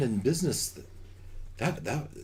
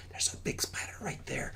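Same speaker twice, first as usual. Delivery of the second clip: hushed warning whisper; 9 dB quieter than usual. Acted. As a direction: whisper so the spider won't hear. GM warns of nearby spider.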